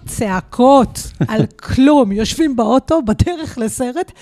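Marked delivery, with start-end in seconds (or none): 0.1-2.1 louder and slow with a laugh on "צעקות"; hammed-up disbelief at the shouting; mock exasperated. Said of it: "אני אומרת לה". (תסכול מוגזם בקול רם ואיטי עם צחוק)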